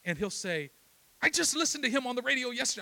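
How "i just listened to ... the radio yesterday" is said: quick, high, eager acted dad; excited reply. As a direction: burst out with the happy coincidence.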